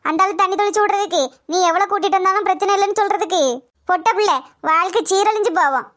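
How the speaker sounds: usual speaking voice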